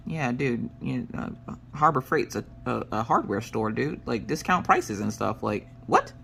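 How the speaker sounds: offhand mumble. Retold or imitated. Casually brushing off husband.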